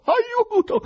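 very high, loud cry for help with falling end; shouted cry. A narrator's reading. Confused Ivan obeys the prankster choirmaster, shouting for help. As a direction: scream for help, drawing out the word. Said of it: "e gridò".

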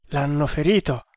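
usual speaking voice

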